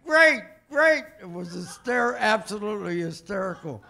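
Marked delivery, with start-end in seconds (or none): 0.0-1.0 much higher, loud cheering "Great, great, great"; loud, high delighted yelling; excited yell. Retold or imitated. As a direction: shout it across the dance floor like a thrilled president. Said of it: "he's yelling".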